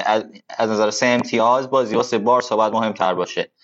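normal talking voice

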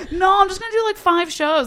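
high, loud protest; hammed-up high-pitched self-justification; mock defensive protest. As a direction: plead your case in a high voice. Acting out own defensive pleading.

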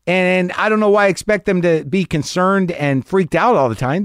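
ordinary talk

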